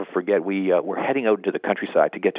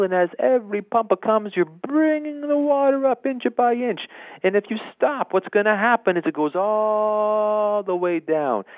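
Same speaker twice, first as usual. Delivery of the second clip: put-on much higher, far-off Italian-accented voice; shouted Italian accent; 13 semitones higher than usual. John explains priming the pump to Ricardo, high excited Italian accent. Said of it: "And John screamed".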